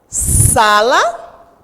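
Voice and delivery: "Sala" with a strong, drawn-out first syllable SA; stress on SA. Shown as a demonstration. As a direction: lean hard on the SA of "Sala". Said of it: "a sílaba mais forte é o sa". ("Sala" com o SA bem forte)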